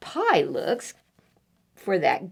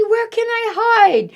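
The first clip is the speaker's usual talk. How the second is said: put-on high, loud frantic child; panicked boy; 13 semitones higher, 5 dB louder than usual. Eddie looking for a place to hide, high panic. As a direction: hunt for a hiding place in panic.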